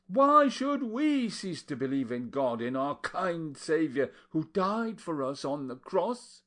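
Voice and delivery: loud, high, preaching rebuke; fiery scolding. A narrator's reading. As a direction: harangue the table like a preacher. Aunt Porreda's tirade at dinner.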